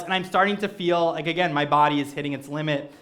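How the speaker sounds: normal talking voice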